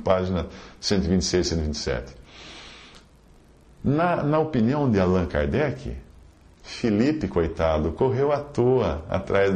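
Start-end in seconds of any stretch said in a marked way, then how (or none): none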